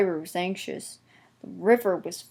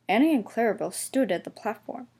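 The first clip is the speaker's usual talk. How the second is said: usual speaking voice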